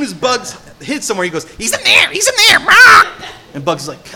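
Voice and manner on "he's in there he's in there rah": shrill, much higher, loud squawking parrot cry with a laugh. Retold or imitated. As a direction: squawk it out like a cartoon parrot tattling. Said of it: "this annoying parrot".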